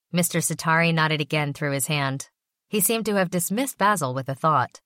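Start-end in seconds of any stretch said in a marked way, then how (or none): none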